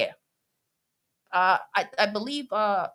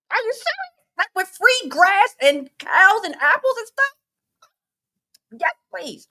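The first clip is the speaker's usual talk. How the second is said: delighted disbelief; 11 semitones higher, 4 dB louder than usual. Retold or imitated. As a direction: relive the thrill, pitched way up. Retells delight at orchard offer.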